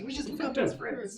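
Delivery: mock sweet roommates. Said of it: "we're like".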